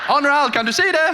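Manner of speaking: high, loud mock call; hammed-up shout across the room in Danish. Jens checks Onral can see the Danish, high loud call. Acting Jens calling out to him.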